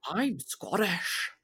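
slow, drawn-out bad Scottish accent; horrible Scottish accent. Mum mimicking her son, hammed-up bad Scottish accent. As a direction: imitate a child's terrible attempt at Scottish. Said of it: "Jonah does a horrible Scottish accent".

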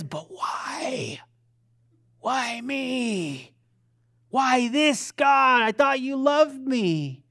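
drawn-out, pleading why-me; lightly acted wounded protest to God; mock plaintive complaint. The flesh questions God in suffering, plaintive.

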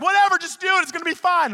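a light take on a carefree grandpa. Retold or imitated. Grandpa brushing off grandson's doubts, lightly voiced.

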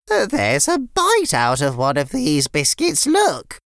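put-on a fond nan greeting the kids; sweet old nan. Nan voiced.

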